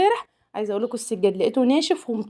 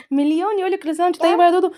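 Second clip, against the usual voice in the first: excited; 9 semitones higher, 4 dB louder than usual. Real, not acted. Excited imagining greetings.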